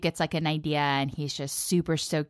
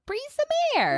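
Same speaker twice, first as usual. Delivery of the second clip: high-pitched echo; slower than usual. Acted. Echoes partner in high voice.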